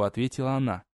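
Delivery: ordinary delivery, nothing marked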